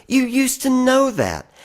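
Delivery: wistful lift. Acted. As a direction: reach up as if reminding an old friend. Apostrophe to Larry.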